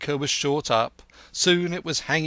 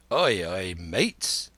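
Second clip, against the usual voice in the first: put-on lower, gruff old pirate; a hint of Long John; 5 semitones lower, much slower than usual. A narrator's reading. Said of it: "said Long John".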